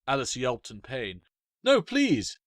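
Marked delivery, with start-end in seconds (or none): 1.6-2.4 high, loud, panicked cry; a girl crying out to fairies attacking her; desperate plea. Acted. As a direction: beg them to stop, terrified.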